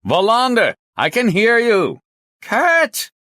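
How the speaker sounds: high, loud phone shouting. Acted. Two policemen shouting names over a crackling line, shouting. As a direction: call out hard across a bad connection. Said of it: "Bjork shouted".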